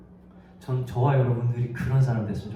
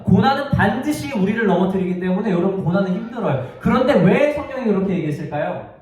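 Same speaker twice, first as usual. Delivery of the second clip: raised, emphatic sermon delivery; impassioned preaching; 8 semitones higher, 4 dB louder than usual. Real, not acted. Preacher telling the congregation suffering truly hurts, impassioned. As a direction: preach it with mounting insistence. Preacher drives home the point.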